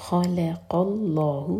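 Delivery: slow with a full, heavy lam in اللہ; drawn-out خلق اللہ with a thick, heavy lam. Shown as a demonstration. Teacher modelling the heavy lam for students, slow chant. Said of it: "لام جلالہ heavy".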